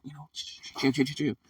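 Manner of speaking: softly shushing the crowd; gentle hushing. Shown as a demonstration. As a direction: settle them down quietly. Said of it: "you're like".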